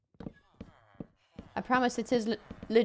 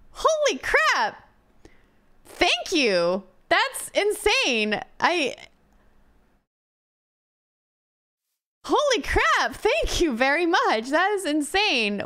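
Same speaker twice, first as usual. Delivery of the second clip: stunned excitement; 6 semitones higher, 3 dB louder than usual. Real, not acted. Reacting to a big donation.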